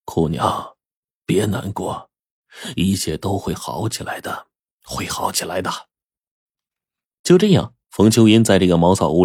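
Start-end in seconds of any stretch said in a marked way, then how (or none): 0.0-5.7 hushed, tender soothing of someone upset; consoling. A narrator's reading. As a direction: comfort gently, almost under the breath. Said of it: "就劝着说".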